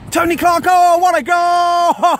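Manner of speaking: loud, slow drawn-out goal yell; long goal yell. Real, not acted. Goal celebration commentary.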